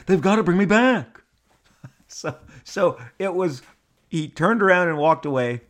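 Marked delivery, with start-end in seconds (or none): 0.1-1.2 high, loud parting shot; indignant. Acted. Shatner turns back after my congratulations, indignant. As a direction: imitate a hammed-up protest, voice raised.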